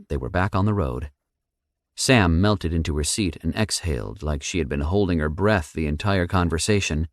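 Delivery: usual speaking voice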